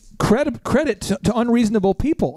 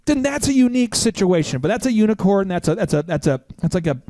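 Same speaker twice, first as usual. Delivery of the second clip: ordinary talk, nothing marked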